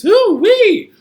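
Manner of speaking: high, loud falling whoop; hoo-wee whoop. Acted. Teacher whooping in a clapping rhyme.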